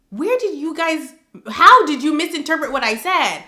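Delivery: exasperated retort. Retold or imitated. Answering commenters who misread her, exasperated. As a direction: challenge them in loud, high bafflement.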